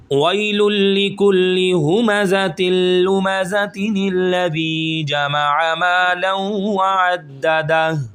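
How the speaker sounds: slow melodic tajweed chant; Quran recitation. Shown as a demonstration. Teacher recites the verses joined for students, melodic chant. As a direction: recite the verses in one flowing breath. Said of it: "پورا ملا کے یہاں تک لے کے آئیں گے".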